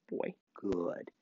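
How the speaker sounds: hushed praise for the dog; quiet praise; soft praise. Real, not acted. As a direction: murmur it to the dog.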